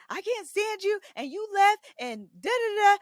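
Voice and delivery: hammed-up blow-up at the husband; screaming mock rant at Kevin; going off. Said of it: "and I'm going off".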